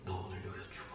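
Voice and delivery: low, breathy ghostly whisper; creepy hushed ghost voice; eerie whisper. Acted.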